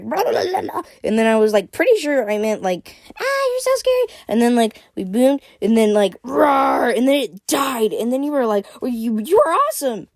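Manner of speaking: breathless high-pitched gushing with roars; excited shouting. A narrator's reading. Azzy retelling the fight to Freya, excited high shouting. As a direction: act out the fight with wild enthusiasm. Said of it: "she shouted".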